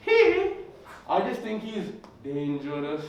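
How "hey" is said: very high, louder falsetto hey; creepy high hey. Retold or imitated. [name] approaching the comic, high falsetto hey. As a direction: pop it up high like the strange singer. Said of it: "he came up to me and he went".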